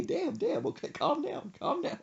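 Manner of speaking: high falsetto grumble; mock mom. Son imitating mom settling down, lightly high. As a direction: soothe in a high put-on voice. Mimicking mom's reaction.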